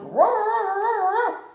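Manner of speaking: high, loud slow roaring. Acted. Tigger roaring as he jumps at the tablecloth. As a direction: pounce with a big roar.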